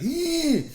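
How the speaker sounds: high, loud imitated baby shriek; baby's high squeal. Dad imitating the baby's sudden loud shriek. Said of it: "he was like".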